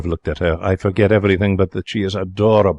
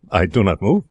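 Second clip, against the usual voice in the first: ordinary delivery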